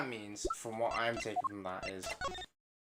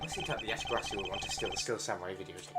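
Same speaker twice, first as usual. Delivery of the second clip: normal talking voice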